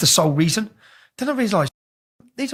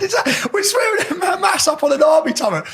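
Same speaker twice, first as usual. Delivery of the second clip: incredulous shout; 10 semitones higher than usual. Real, not acted. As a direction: shout it, laughing at the madness. Laughing disbelief at own story.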